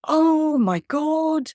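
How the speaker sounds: shocked gasp. Retold or imitated. Colleagues reacting to his rudeness, drawn-out shocked gasp. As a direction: colleagues aghast at the man, shocked slow gasp. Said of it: "His colleagues were going".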